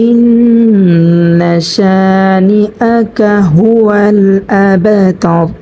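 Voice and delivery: slow, drawn-out tajweed chant; Quran recitation. Shown as a demonstration. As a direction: recite it slowly, stretching the vowels. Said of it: "যেমন".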